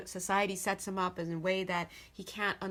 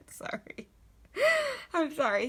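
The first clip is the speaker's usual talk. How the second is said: apologising through giggles; helpless laughter; 8 semitones higher, 4 dB louder than usual. Real, not acted. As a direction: squeeze out an apology while laughing. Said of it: "I'm sorry, 'cause I'm laughing".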